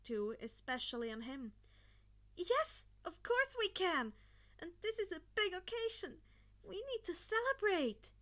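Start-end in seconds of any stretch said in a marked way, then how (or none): 2.3-8.1 higher, louder, reassuring mother voice. A narrator's reading. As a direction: reassure her son with a little lift. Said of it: "Inko reassured".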